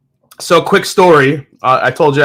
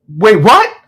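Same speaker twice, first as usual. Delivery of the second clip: shocked disbelief; 7 semitones higher, 4 dB louder than usual. Retold or imitated. Retold shock at the text.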